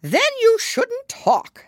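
very high, loud, slow put-down; rude Hatter. A narrator's reading. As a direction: snap like the Mad Hatter.

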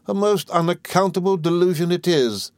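high-pitched, indignant exclamation; scandalised sister's high outcry; crying out. A narrator's reading. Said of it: "cried Miss Murdstone".

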